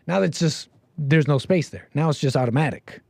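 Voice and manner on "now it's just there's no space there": ordinary talk, nothing marked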